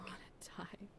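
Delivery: low breathy whisper; despairing whisper. Acted. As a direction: barely voice it, hopeless. Despairing confession into her back.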